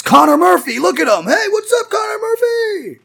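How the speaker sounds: hyped announcer. Pretend. Hyping a one-man buddy cop hero, loud announcer call. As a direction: shout it like introducing a big star.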